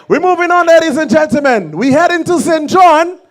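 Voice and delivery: high, projected stage introduction; event announcer. Real, not acted. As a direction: announce the next act to the crowd. Announcer introducing the next act.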